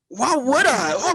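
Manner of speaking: high, loud, incredulous comeback; high-pitched outraged reply; indignant retort. Retold or imitated. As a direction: fire back in loud disbelief. Retells indignant reply to stranger.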